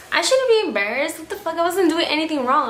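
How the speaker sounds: high, indignant self-talk, a bit quick; indignant retort. Retold or imitated. To herself on the dance floor, indignant and high. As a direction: talk yourself out of shame, outraged.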